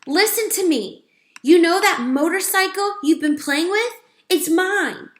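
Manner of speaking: high, loud squeaky mouse voice; as loud as he can. A narrator's reading. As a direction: strain to be heard, tiny but loud. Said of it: "as loud as he could be".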